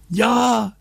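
high squeal of agreement; tiny character squealing in panic; shouted. Acted. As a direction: give in with a high cry. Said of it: "schrie jetzt auch Lotto".

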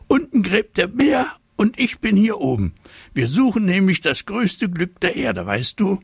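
high eager tiger voice; chatty and proud; high-pitched tiger, keen to share; little tiger. A narrator's reading. Tiger explains to mole.

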